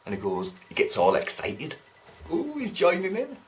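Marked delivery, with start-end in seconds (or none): 2.3-3.4 higher, mocking his eagerness; mock excitement. Mimicking the joker's glee at joining in.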